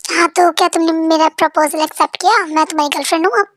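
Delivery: ordinary delivery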